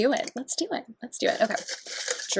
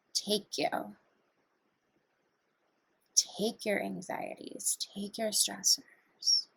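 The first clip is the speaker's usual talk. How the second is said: guided meditation; 5 semitones lower, 3 dB quieter than usual. Acted. Guiding viewers through breathing, in a calm meditation voice. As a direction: talk them gently through each breath.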